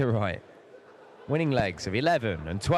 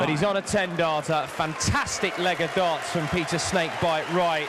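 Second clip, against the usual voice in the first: excited commentary; 6 semitones higher than usual. Real, not acted. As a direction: shout praise for the leg. Commentator excited by great leg.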